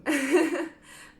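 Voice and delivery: a high, bright teary giggle; teary laugh. Real, not acted. Reader moved by Pile Three's message, teary giggle. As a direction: laugh through the emotion. Emotional laugh mid tarot reading.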